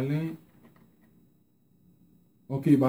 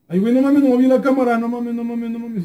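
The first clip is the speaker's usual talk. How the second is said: louder, higher alarmed burst; alarmed outburst; 6 semitones higher, 4 dB louder than usual. Real, not acted. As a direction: blurt it in alarm. Game lag panic. (alarma porque se traba el juego)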